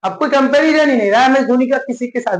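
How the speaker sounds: ordinary delivery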